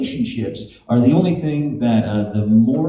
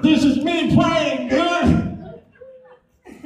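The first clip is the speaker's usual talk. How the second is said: desperate shout; much slower than usual. Retold or imitated. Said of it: "I'm like".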